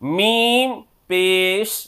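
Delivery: slow, drawn-out chant that rises on each vowel mark; qaida recitation drill. Shown as a demonstration. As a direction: sound out each syllable for students to copy. Teacher drills letters with vowel marks. (నెమ్మదిగా, రాగంతో అక్షరాలు పలకడం)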